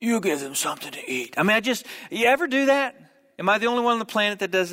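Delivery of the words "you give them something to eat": low, quieter mob boss growl; Jesus as a mob boss, quietly menacing; mafia voice. Pretend. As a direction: lean in like a mafia don making an offer. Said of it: "like, a mafia voice?".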